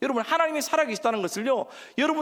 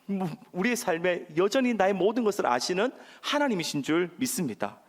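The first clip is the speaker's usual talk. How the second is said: ordinary talk, nothing marked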